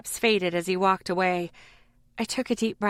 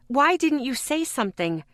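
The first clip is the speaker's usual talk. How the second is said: shouting; 6 semitones higher than usual. A narrator's reading. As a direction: keep yelling at him. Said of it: "I couldn't seem to stop shouting".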